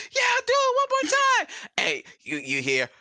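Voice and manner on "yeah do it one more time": very high, loud crowd shout; bar crowd shouting. Acted. The bar crowd demands an encore, shouting high and loud. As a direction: roar it like a whole bar. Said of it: "The bar's like".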